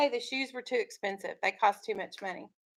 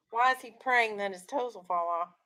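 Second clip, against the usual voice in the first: normal talking voice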